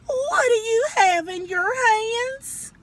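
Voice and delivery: high, slow, loud scolding mother; scolding mama. A narrator's reading. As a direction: call him out like a shocked mama. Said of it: "and she said".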